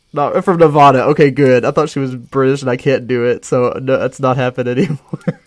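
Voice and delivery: loud, excited relief. Real, not acted. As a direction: cheer it out, relieved. Relieved outburst at chat.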